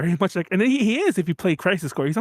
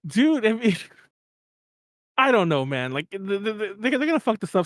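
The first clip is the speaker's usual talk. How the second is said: higher, talking through laughter; laughing; 4 semitones higher than usual. Real, not acted. Co-host cracking up at the whole thing, laughing. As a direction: try to get it out while laughing. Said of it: "Sorry, I'm laughing".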